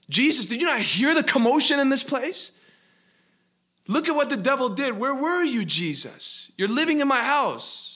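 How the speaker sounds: high, loud, indignant outcry at Jesus; angry complaint. Acted. Man shouting at Jesus about the mess the devil made, angry. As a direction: demand to know where he was, shouting. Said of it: "He thought to himself".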